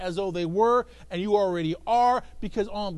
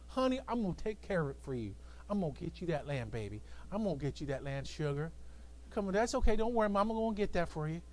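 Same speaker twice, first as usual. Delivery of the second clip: lower, softer mock baby talk; coddling; 4 semitones lower, 8 dB quieter than usual. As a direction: coo it to a pouting husband. Jezebel coddling the king.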